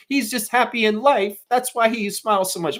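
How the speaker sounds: high, put-on voice of a doting mom; mocking a defensive mother. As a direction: mimic a mother sticking up for her son. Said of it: "Michelle Carter come out and defend her son".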